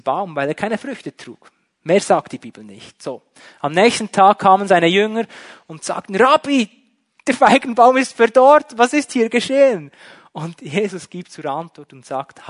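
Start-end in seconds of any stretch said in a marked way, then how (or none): 6.2-9.9 astonished disciples. Acted. Disciples report withered fig tree.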